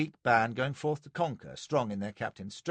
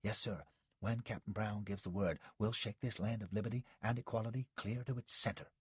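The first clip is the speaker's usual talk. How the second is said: dropped voice; 8 dB quieter than usual. A narrator's reading. Jerry confiding to Josh out of Sanborn's earshot, hushed. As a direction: keep it down so the host won't hear. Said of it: "He had dropped his voice".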